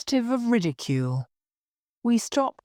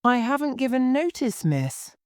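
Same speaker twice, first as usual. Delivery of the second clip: higher, indignant maid, dropping on "miss"; sharp retort; 6 semitones higher than usual. A narrator's reading. Maid answering her mistress back, sharply. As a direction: contradict her firmly. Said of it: "Million took me up sharply".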